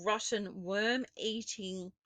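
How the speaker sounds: plain reading voice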